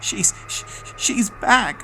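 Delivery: high, breaking gasp of terror; choked out. Acted. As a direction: force the words out through fear. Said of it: "I choked out".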